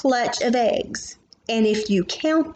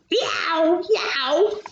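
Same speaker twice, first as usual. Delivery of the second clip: higher crying yowl; tomcat yowl; 7 semitones higher than usual. Acted. Reading to child, yowling like the tomcat.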